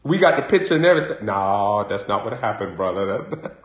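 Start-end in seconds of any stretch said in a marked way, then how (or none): 1.2-3.5 low mumbling denial as Willie; Willie denying. Retold or imitated. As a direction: play the guilty man who brushes it off low. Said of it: "But Willie Earl".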